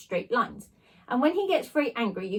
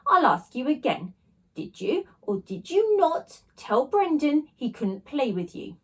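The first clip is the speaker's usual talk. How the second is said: stern teacher; 5 semitones higher, 4 dB louder, slower than usual. A narrator's reading. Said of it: "Mister Irons turned to the new boy".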